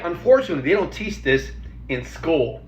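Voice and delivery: ordinary talk, nothing marked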